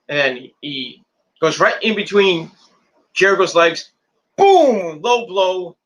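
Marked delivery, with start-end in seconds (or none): 4.4-5.8 high, loud, drawn-out boom; exclaimed impact. Real, not acted. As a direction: punch it out high and loud like a hit landing. Calls the low blow's impact.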